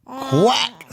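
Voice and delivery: high-pitched quack; duck quack. Acted. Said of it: "says the duck".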